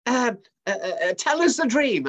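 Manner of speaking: high, loud, stammering panic; flustered astrologers. Acted. Astrologers stalling before the king, high flustered stammer. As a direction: caught out and scrambling for words. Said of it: "They said".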